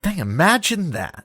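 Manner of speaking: high, mock-amazed sarcasm; sarcastic. Real, not acted. Co-host teasing his friend's changed opinion, mock amazed. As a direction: tease him with fake astonishment. Deadpan reaction to admitted embarrassment.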